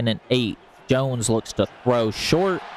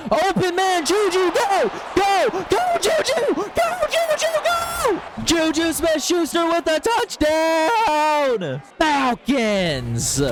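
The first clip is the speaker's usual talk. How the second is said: real excitement; slower than usual. Real, not acted. Gamer calling his team's touchdown, ecstatic yelling. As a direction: lose it completely as the receiver breaks open. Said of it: "Sorry, I got real excited".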